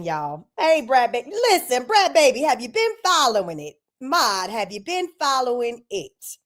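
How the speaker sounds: ordinary talk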